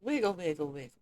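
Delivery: talking through a mouthful of salad; words round a bite of food; mouth full. Real, not acted. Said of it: "Sorry, my mouth is full because I'm eating a salad".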